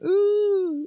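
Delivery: high, loud ghostly "ooh"; mock spooky ooh. Mouse spooking the elephants, mock ghostly ooh. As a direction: wail a playful ghost noise.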